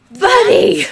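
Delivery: high, loud cry of disbelief; exasperated shout. Real, not acted. Reader yelling at a disappointing father character, exasperated shout. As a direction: yell it at the character in frustration.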